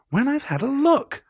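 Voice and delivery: high, sing-out teasing refusal; taunting. A narrator's reading. Malfoy waving the diary at Harry, high and taunting. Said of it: "waving the diary tauntingly at Harry".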